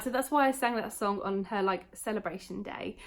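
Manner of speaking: ordinary delivery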